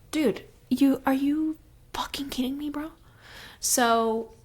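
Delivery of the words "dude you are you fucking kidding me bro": incredulous bro. Retold or imitated. Hosts retelling Rhysand catching Tamlin, mock incredulous. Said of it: "So Rhysand comes in and is like".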